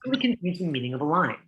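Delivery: ordinary talk, nothing marked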